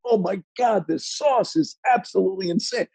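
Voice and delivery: very high, hammed-up foodie raving; mock rapture. Diner raving about the sauce mid-entree, mock ecstatic. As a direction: swoon in a high voice over the food.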